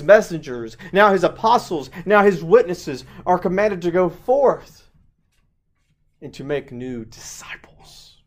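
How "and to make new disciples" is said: hushed whisper on make new disciples; whispered emphasis. Real, not acted. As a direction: drop to an intimate whisper. Whispered close.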